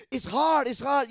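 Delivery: high, loud mock wail of it's hard; mock complaining. Preacher to congregation mocking complainers, loud high whine. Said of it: "and you start complaining, say".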